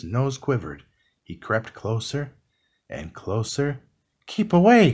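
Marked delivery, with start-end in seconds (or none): 4.3-4.9 loud, high-pitched cry of warning; urgent storybook shout; cried warning. A narrator's reading. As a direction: call out sharply to warn a friend. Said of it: "cried Gus".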